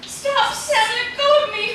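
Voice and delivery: high, loud cry to be let go; frightened shout. Acted. Wife fighting off her husband, shouting in fear. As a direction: pull away, shouting in fear.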